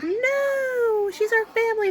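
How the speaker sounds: put-on high upset girl's protest; little sister protesting. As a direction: squeak it out like a shocked younger sister. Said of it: "She's like".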